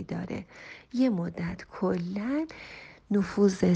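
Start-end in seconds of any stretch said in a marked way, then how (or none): none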